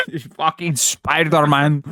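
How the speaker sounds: slow, mangled Scottish attempt; bad Scottish accent. Party performer announces Spider-Man, botched Scottish accent. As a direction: drag it out in broad, failed Scottish. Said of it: "I can't do Scottish".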